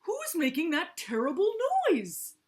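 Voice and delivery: high, loud indignant question; loud outcry. A narrator's reading. Walrus asks about terrible noise, loud high outcry. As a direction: cry out over the din.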